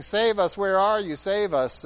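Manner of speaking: hammed-up plea to be rescued; mock scream for help. People crying out to God, mock screaming for help. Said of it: "you come screaming to me saying".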